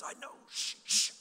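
whispered, acted-out shush; urgent "keep it down" whisper; hushed shushing. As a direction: whisper it to hush a friend. Friend shushing him during the program.

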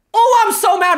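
mock tantrum; mock anger. Real, not acted. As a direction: shout it playfully furious.

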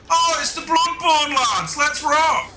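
very high, hyped-up squeal, drawn out slowly; rally for a fight in a silly high voice; mock battle cry. Gamer hailing the Bloodborne lads as a fight starts, hyped mock battle cry. Gamer greeting attacking enemies.

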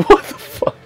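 laughed out, breathy and slow; laughing in disbelief. Real, not acted. Laughing at game mishap.

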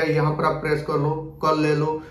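normal talking voice

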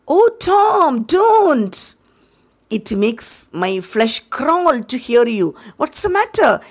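put-on high, upset boy's voice; distressed boy.

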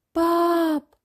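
put-on child calling for dad, high and drawn out; high, sustained call. A narrator's reading. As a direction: call "Pap" on a long, high held note. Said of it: "roept Job".

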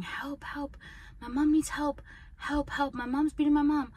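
high pleading cry for help; retold screaming for help. Child at kitchen window calling to cops, high pleading yell. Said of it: "I'm screaming, I'm screaming".

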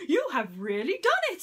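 high, loud gloating prince; gleeful Prince John. Acted. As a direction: crow over the trick like a spoiled prince. Prince John gloats over the straw.

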